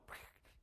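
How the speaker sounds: quick imitated water burst; lip-made spurt of water; spurting leak noise. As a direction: sputter like a pipe springing a leak.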